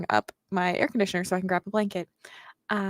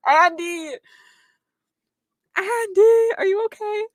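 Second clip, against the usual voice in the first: concerned calling out; 11 semitones higher, 5 dB louder, slower than usual. Real, not acted. Calling out to upset friend.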